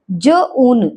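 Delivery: ज, ऊन in two separate parts; sounded out. Shown as a demonstration. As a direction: sound out ज, then long ऊन. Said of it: "Now ऊ sound". (ज, ऊन अलग-अलग करके)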